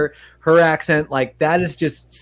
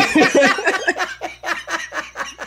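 laughing; 12 semitones higher than usual. Real, not acted. Laughing at own quote.

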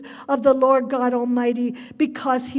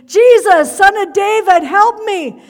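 begging at full voice for help; desperate shout; 6 semitones higher, 11 dB louder than usual. Acted. Blind beggar shouting to Jesus from the roadside, loud desperate cry. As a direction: cry out at the top of your lungs, higher and much louder. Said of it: "He yelled louder".

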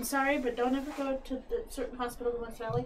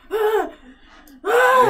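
high, loud mock scream "Ah"; imitated scream; 12 semitones higher, 13 dB louder than usual. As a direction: yell it like someone hurt. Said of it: "It was just this loud".

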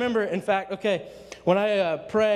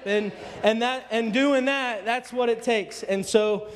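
ordinary talk, nothing marked

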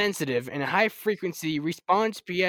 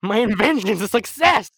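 loud, proud exclamation; excited declaration; triumphant; 5 semitones higher, 7 dB louder than usual. Acted. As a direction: crow about the success. Invention succeeds.

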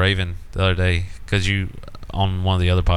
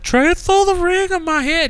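very high, drawn-out imitation squeal; mock crying girlfriend; slower than usual. Girlfriend phones her dad about the ring, mock tearful squeal. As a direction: wail it slowly, mimicking her. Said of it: "why are you crying".

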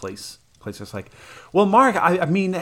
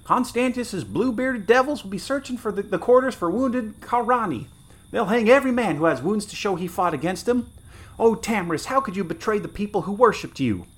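much higher, pained lament; anguished soldier; 10 semitones higher than usual. Acted. Wounded soldier lamenting to the girl, anguished. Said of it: "muttered the wounded".

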